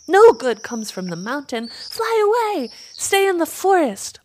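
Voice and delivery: high, loud warning cries; shrill little birds urging escape; alarmed swifts. A narrator's reading. Frightened swifts warn Crow.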